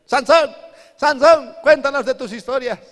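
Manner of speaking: put-on high, loud taunting kids' voices; mocking youths. Preacher imitating Philistine boys taunting Samson, high and loud. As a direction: call out like jeering boys, fast and shrill with glee. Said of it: "Se reían y decían".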